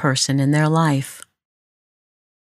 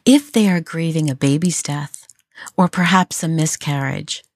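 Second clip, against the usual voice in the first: usual speaking voice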